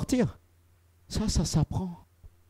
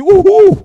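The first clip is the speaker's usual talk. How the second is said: mock wife's cooing; 14 semitones higher, 14 dB louder than usual. Mocking wife's reaction.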